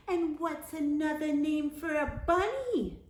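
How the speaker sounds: high knowing classmate voice; knowing tease. A narrator's reading. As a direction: ask it like you know the trap. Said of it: "they asked knowingly".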